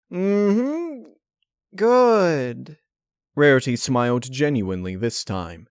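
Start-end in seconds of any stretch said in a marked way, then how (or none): 0.1-2.8 much higher, strained pleasant hum; forced smile. Acted. Twilight pretending the cupcake tastes good, forced pleasant. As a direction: fake the enjoyment politely. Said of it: "Twilight forced a smile".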